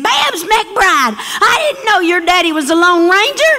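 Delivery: a sixth grader who can't believe it; amazed classmate. Retold or imitated. Bubba tells the narrator about her dad, high and amazed.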